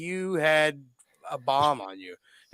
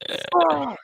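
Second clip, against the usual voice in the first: sharp higher mock curse; imitating angry curse; 11 semitones higher than usual. Angry friend cursing before throwing the hat, high mock curse. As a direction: spit out the curse, much higher. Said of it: "and he's like".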